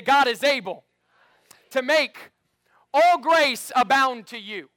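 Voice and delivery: loud, high, forceful declaration; mean every word, full volume; say it like you mean it. Shown as a demonstration. Pastor urging the church to say it with conviction. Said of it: "Say it like you mean it".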